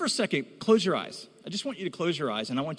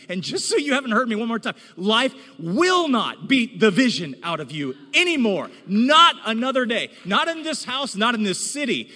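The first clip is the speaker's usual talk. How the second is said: loud, high, rising preacher's call; preaching at full force to the church; 6 semitones higher, 5 dB louder than usual. Acted. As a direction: drive it home to the church like a revival.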